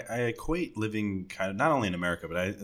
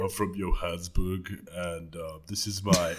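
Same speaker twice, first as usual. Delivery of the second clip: Jeremy Clarkson; 2 semitones lower than usual. Acted. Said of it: "I'll do an impression of him".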